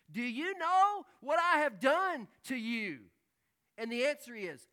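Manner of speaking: high, loud sermon voice, slowing on the last question; impassioned preaching. Acted. Preacher voicing Jesus to the congregation, loud and fervent. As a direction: thunder the words of Jesus. Quoting Jesus at full force.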